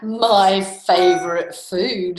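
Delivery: loud, slow monster voice; drawn-out, booming storybook beast; Gruffalo. Acted. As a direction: savour it heavily, as a hungry monster. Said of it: "the Gruffalo said".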